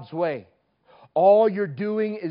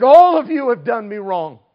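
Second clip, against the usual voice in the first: loud, raised voice pointing at the crowd; accusing call; 5 semitones higher, 10 dB louder than usual. Retold or imitated. Girl at the microphone accusing the teenagers, loud and raised. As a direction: call them all out loudly.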